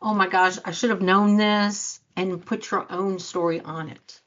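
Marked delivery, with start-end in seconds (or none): 0.0-2.0 loud flustered panic; panicked gasp. Retold or imitated. Coach imitating a panicked reaction, loud and flustered. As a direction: blurt it out in alarm. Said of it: "would you right away go".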